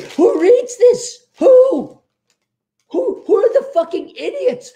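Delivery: high, loud disbelief; incredulous. Acted. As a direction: demand it, stunned, voice leaping up. Ranting at the fine print.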